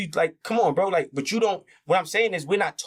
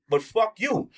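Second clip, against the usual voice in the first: ordinary talk, nothing marked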